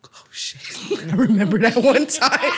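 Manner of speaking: put-on scared kid line through a laugh. Kid recalling mom's one spanking, laughing. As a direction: laugh while remembering. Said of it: "And you'd be like".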